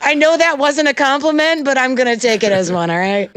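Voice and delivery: very high, loud, gleeful defiance; excited defiant retort. Real, not acted. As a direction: shout it out gleefully.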